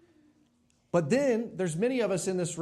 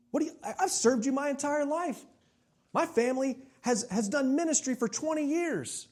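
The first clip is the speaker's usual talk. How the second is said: much higher, pleading and aggrieved; indignant complaining prayer; 9 semitones higher than usual. Retold or imitated. Pastor voicing his own prayer, high aggrieved complaint to God. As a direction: whine at God like you've been cheated. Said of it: "That sounds like my prayer time".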